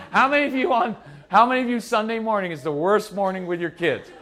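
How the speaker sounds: usual speaking voice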